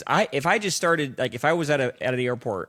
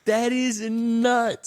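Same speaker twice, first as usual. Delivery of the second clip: high, drawn-out amazement; stunned, slow and pitched up; astonished; 10 semitones higher, much slower than usual. Real, not acted. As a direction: stretch every word in disbelief. Astonished reaction to the lie.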